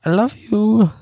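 squeaky high-pitched voice; high pet voice. Pretend. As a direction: say it like a tiny cute pet. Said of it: "Like, sounding like that?".

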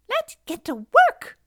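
very high, loud, slow squeak; squeaking land crabs. A narrator's reading. Land crabs start on the cat's side, squeaking. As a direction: squeal a rallying call like tiny creatures.

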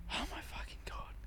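whispered under the breath, fed up; exasperated whisper. Retold or imitated. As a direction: breathe it out in a whisper, head in hands. Head down on desk, fed up.

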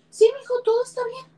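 high, forced bright voice, hiding tears; a mother pretending all is fine while crying; tearful mom chirping that everything's okay; fake cheerful mom. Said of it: "la lágrima saliéndote y tú".

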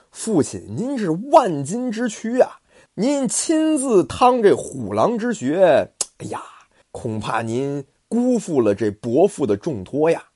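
稍高、放慢的苦劝; 焦急地恳求父亲别去; 关平担忧. Acted. 关平担忧劝父. (儿子劝父亲，担忧恳切)

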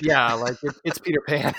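laughing through the words. Real, not acted. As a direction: giggle as you say it. Laughing at own joke.

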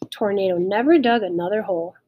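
usual speaking voice

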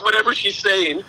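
normal talking voice

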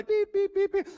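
high, loud electronic beeps; imitated detector beeps. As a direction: sound like a detector going off. Mimics a treasure detector going off.

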